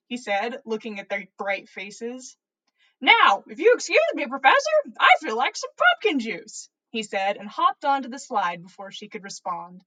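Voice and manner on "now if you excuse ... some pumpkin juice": loud, quick, cheeky send-off; gleeful exit. A narrator's reading. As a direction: bounce away with glee. Dumbledore excusing himself gleefully.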